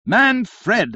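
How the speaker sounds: high, loud indignant bawl. A narrator's reading. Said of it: "here bawled out Miss Barbleu".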